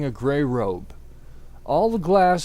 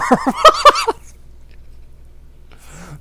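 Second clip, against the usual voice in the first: real breathless laughing fit; real unvoiced laugh; 8 dB louder than usual. Real, not acted. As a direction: crack up in a high breathless laugh. Reader breaks into laughter.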